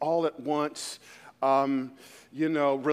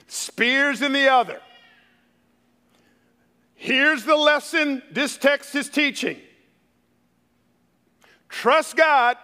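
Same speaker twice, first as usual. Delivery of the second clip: raised, high-pitched sermon punchline; full-force preaching; 7 semitones higher, 7 dB louder than usual. Real, not acted. Preacher giving congregation the lesson of the text, full-force preaching. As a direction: drive the lesson home to the crowd.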